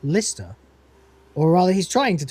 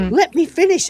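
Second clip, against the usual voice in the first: much higher and louder, cutting someone off; insistent shout; 12 semitones higher, 6 dB louder than usual. Retold or imitated. Captain silencing his robot, loud and high. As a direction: demand the floor, shouting over them.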